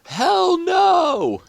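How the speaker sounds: the whole crowd yells it back; crowd shouting. Acted. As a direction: crowd answering the question, a loud high shout. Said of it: "you hear most of the crowd go".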